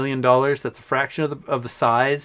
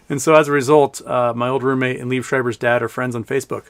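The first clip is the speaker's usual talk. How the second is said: ordinary talk, nothing marked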